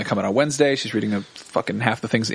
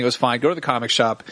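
ordinary delivery

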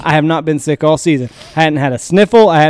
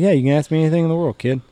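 fast, breezy dad answer; dad quickly reassuring his son, fast; rushed eager reply; 5 dB quieter, much faster than usual. Retold or imitated. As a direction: rattle it off to reassure the kid.

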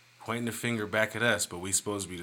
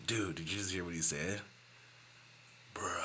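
quieter laid-back dude voice; soft Wesley impression; 3 semitones lower, 11 dB quieter than usual. Practising the friend's voice, softly. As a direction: murmur it like a chill dude. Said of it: "You gotta get your Wesley right".

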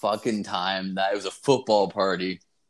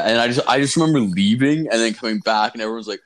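ordinary talk, nothing marked

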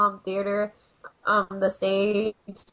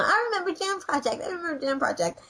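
high, insistent echo of oneself; quoting herself, pitched well up; excited self-quote; 7 semitones higher than usual. Retold or imitated. Co-host repeats what she said before guest came on, high insistent self-quote. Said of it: "I was just saying that".

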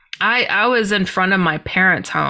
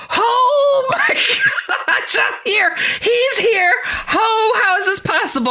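crying out in awe. Retold or imitated. Overwhelmed by his presence, a high tearful cry. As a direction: cry and shout in disbelief.

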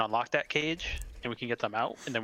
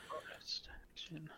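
whispered aside; 4 semitones higher, 17 dB quieter than usual. Real, not acted. Aside muttered quietly.

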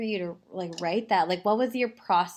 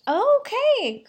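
sudden high surprised okay; 6 dB louder than usual. Real, not acted. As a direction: jump up in surprise. Hears song was for Pride.

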